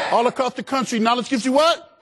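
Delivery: high, rising rally cry; rousing crowd call. Real, not acted. Speaker asking students all across the country, rousing high call. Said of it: "I can't hear you".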